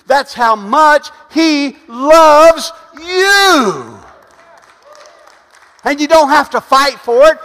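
loud, slowing preaching climbing to a shout; preacher building to a shouted climax; full-force preaching. Acted. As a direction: drag out each word, then shout the last. Preaches to congregation.

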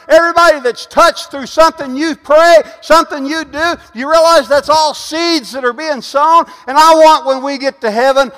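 loud, high preaching with a driving build; full-force preaching. Acted. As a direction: preach it out to the whole room. Preaches to congregation.

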